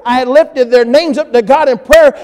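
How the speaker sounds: building preaching. Acted. Pastor preaching to congregation, building to full force. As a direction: lift it up into a preaching climax.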